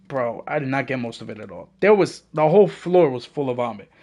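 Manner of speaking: normal talking voice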